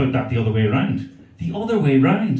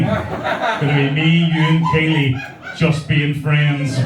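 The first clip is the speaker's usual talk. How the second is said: slow teasing; much slower than usual. Acted. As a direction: stretch each word, laughing. Teasing audience, drawn out.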